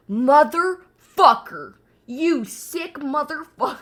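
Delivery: slow, loud mock-outraged yell; yelled hard R outrage. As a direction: yell it drawn out, hitting the hard R. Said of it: "Why am I yelling?".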